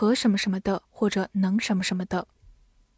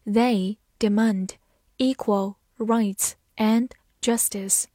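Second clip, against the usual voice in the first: slow, careful read of They demand equal rights and justice; slower than usual. Shown as a demonstration. Teacher to students, reading slowly so they can follow. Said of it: "好，慢慢来读。".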